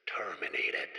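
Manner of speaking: whispered, menacing and low; sinister whisper. Acted. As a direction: breathe the word like a dark secret. Villain reveals sinister plan.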